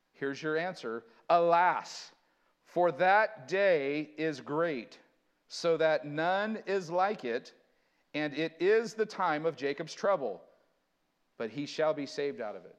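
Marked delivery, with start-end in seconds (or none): 1.3-12.8 slow, loud, weighty scripture; solemn proclamation. Acted. Preacher reads the answer verse aloud, slow and loud. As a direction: declare it slowly like a lament.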